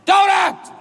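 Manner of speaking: high, punchy shouted bursts; Al Pacino. Retold or imitated. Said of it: "Al Pacino says".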